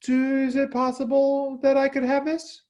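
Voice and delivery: high, hesitant pleading question; timid asking. Shown as a demonstration. Parent timidly asking permission, high and unsure. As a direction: ask meekly, afraid of a no. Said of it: "Instead of saying ... we say".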